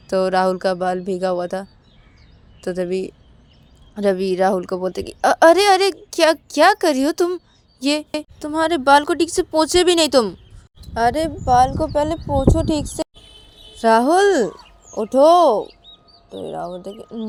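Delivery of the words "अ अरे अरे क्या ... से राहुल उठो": high, loud, exclaiming reproach; fussing scolding. A narrator's reading. Ravi telling wet-haired Rahul to get up, scolding. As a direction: scold a loved one, drawing out the commands.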